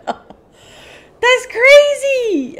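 high, loud, long-drawn exclamation; thrilled disbelief. Real, not acted. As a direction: stretch it out loud in delighted disbelief. Stunned by milestone.

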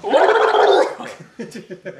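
high, loud burst of laughter. Real, not acted.

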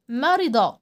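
quick kasra in مرض; short i in مرض. Shown as a demonstration. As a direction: keep the i of مرض brief. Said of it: "الكسرة حركة قصيرة".

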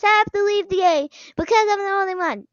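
normal talking voice

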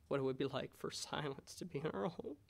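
ordinary talk, nothing marked

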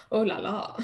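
giggling through oh la la; laughing. Real, not acted. Guest laughing at the host's joke, giggling. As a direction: laugh it off, amused.

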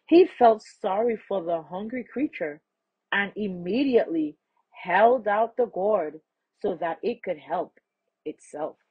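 ordinary delivery

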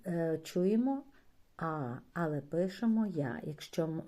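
ordinary talk

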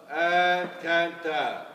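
slow, stretched and loud; drawn-out called command. Real, not acted. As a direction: stretch the word out like a call. Calling the next gait.

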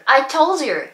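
"I told you" said as "I tol-juu"; linked pronunciation. Shown as a demonstration. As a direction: run told into you with a j sound in "I told you". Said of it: "っていう風に言いますよね". (「I told you」をアイ・トウジュウとつなげて発音)

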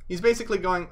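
ordinary delivery

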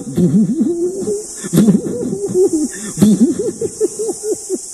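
high put-on cackling laugh; imitated signature laugh. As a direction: do his famous laugh, high and hooty. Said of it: "He had the laughter went like this".

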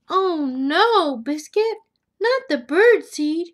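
high, loud mock dismay; dismayed cry. Owner sees puppy spill seed, loud dismay. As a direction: cry out as the mess happens.

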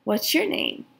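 ordinary delivery

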